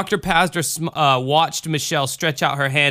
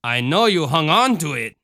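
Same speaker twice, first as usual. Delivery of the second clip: put-on Cajun accent, calm question; lilting Cajun mother, not hushed; Ma Marie; 3 semitones higher than usual. A narrator's reading. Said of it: "Ma Marie said".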